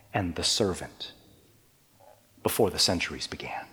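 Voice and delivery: quick hushed aside in awe; whisper. Acted. Preacher marvels to the congregation in a fast whisper. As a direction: let it out as an awed secret. Awed remark on eternal conversation.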